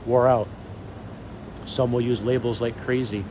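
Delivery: ordinary delivery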